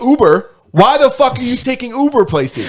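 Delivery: mock outraged yell. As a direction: bark it like a disbelieving money coach. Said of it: "he'd be like".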